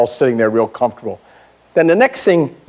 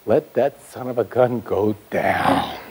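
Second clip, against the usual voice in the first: put-on slow, low, softer sneer of a little kid; gleeful kid; 4 semitones lower, 7 dB quieter, slower than usual. Six-year-old in the front row wanting the man to sink, slow low sneer. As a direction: savour it like a kid glad to see him sink. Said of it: "what that six-year-old kid said".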